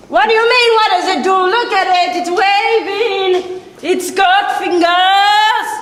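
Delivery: excited shout. Real, not acted. Shows off the waving plant.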